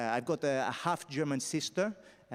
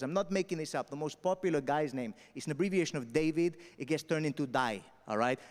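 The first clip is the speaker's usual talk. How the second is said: normal talking voice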